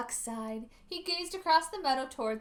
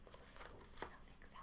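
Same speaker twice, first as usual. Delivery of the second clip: breathy whispered aside; a secret whispered aside; 20 dB quieter than usual. A narrator's reading. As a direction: lean in and whisper it to the child. Narrator aside to listener.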